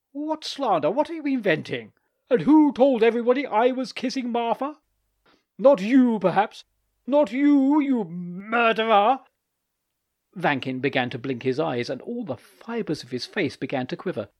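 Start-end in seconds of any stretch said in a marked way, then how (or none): 0.1-9.2 high, loud outraged yelling; angry shout. Acted. Furious husband shouts at Vankin, loud outraged yell. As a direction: hurl the accusation, voice cracking high.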